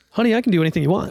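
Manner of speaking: fast, lightly put-on confident landlady; Judy's confident voice. Landlady promising the owner anything, fast and confident. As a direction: breeze through it, confident. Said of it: "She goes".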